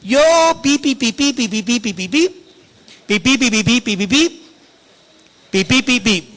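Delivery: playful beeps standing in for curses; chirpy censor beeps for Peter's curses; mock bleep censoring. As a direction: bleep out the swearing with quick pi pi sounds. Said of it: "Pedro comenzó con groserías".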